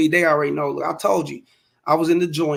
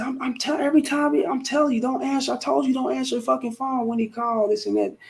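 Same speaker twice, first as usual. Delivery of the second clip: pitched up, mock-scolding girlfriend voice; imitating his girlfriend; 9 semitones higher than usual. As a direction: ham up the girlfriend scolding him over the phone. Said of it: "she said".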